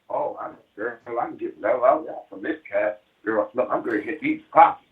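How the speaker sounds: lightly voiced gold digger; scheming woman. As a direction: plot how to use him, hushed. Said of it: "the minute you start with that".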